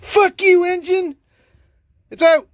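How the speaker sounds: high, loud victory yell; crowing loudly over a beaten enemy; triumphant shout. Real, not acted. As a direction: shout it in gleeful defiance. Victory over the stubborn engine.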